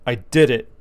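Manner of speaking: loud, punched announcement; bursting-in announcement. Retold or imitated. Friend bursting into the bedroom, loud announcement. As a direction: imitate someone barging in with big news. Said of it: "bursts in the room like this".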